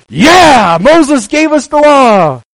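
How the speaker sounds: high, excited crowd voice; eager crowd. Retold or imitated. Crowd boasting to Jesus, excited and high. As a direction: play the proud crowd. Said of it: "And everybody's".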